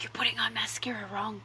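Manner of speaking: hushed judgmental whisper; whispered quote. Retold or imitated. Judging a stranger's mascara, whispered under her breath. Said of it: "and I was like".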